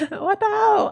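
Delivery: high, loud laughing outburst; laughing disbelief. Real, not acted. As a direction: squeal it in amused outrage. Laughing at being called British.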